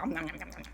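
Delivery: soft imitated gobbling; dinosaur munching noises. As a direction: chomp through the syllables quickly.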